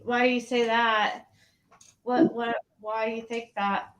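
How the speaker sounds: normal talking voice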